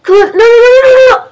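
loud rising cries of no; desperate high shouted no; panicked no's. Real, not acted. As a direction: beg it not to happen, loudly. About to fail, crying out.

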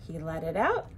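plain reading voice

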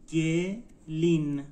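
گلین slowly as گ, pause, لین; slow teaching demo of گلین, split in two; syllable by syllable. Shown as a demonstration. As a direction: sound out گلین in two separated beats. Teacher breaks word into syllables.

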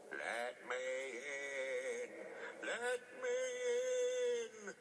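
high, very slow ghostly wail; drawn-out eerie howl; spooky ghost voice. A narrator's reading. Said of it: "said Percy in a spooky voice".

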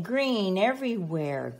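normal talking voice

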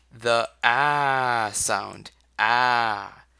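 "ah" drawn out slowly as an open ah vowel; saying ahh. Shown as a demonstration. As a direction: stretch "Ah" into a long, clear ah. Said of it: "it makes the ah sound".

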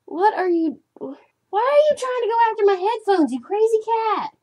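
high, loud startled scolding; exasperated at the cat. Real, not acted. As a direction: shoo the cat off your headphones. Cat attacking headphones.

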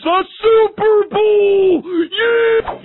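Super Bowl yelled high, then a cheering Yeah; yelled punchline. Acted. Joke teller hollering the punchline, high-pitched hype yell. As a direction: shout the punchline like a hype man.

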